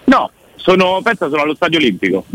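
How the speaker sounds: high, lively and fast; emphatic italian speech. Real, not acted. Caller jokes about his location.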